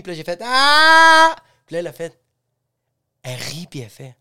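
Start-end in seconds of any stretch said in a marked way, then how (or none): 0.4-1.3 high, long, loud "ah"; loud mock laugh-cry. Imitating the educator after her sentence, loud mock outburst.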